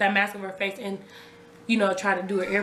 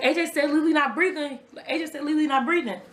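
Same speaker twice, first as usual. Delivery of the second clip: raised, urgent repeated report; retold alarm; 7 semitones higher than usual. Mother telling her sister on the call, urgent alarm. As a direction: replay the panicked news, pitched up.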